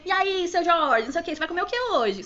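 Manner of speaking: imitating loud, jovial staff chit-chat; mock breezy workplace joking; casual banter. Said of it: "aquela vibe meio descontraída".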